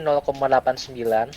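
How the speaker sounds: ordinary talk, nothing marked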